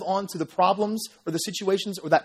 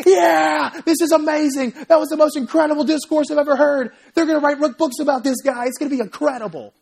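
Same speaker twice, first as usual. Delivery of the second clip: much higher and louder, shouting in delight; cheering crowd; 7 semitones higher, 6 dB louder than usual. Retold or imitated. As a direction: cheer like the whole crowd just saw a win.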